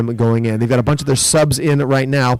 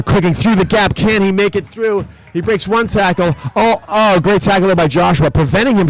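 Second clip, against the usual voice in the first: excited call; 6 semitones higher than usual. Real, not acted. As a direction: gasp at the big tackle. Live call of a break.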